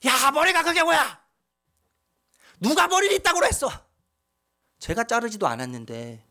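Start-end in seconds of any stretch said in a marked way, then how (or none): 0.1-3.7 high, sharp scolding as his father; imitating angry father. Son retelling his father yelling about the haircut, mock angry. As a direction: mimic dad snapping at his haircut. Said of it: "아버지가 갑자기 저기 화를 내시는 거예요".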